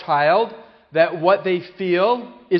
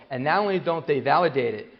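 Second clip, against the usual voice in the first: ordinary talk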